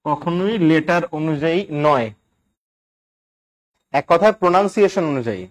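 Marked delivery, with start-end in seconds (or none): 0.1-2.1 slow and deliberate, each word spaced for note-taking; slow dictation. Shown as a demonstration. Teacher dictating a rule slowly to note-taking students. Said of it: "এই জন্য শুনে লিখবেন".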